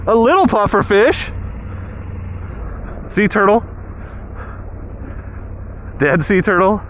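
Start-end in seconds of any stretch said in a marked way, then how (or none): none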